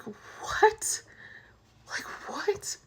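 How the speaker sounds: high, breath-only gasped question; whispered retold disbelief, rising sharply; shocked. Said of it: "I was so shocked".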